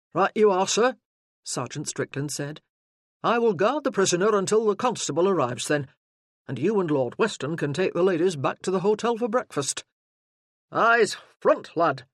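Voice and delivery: a burly sergeant taking charge; booming soldier arriving in force; gruff sergeant. Acted. Sergeant guarding prisoner.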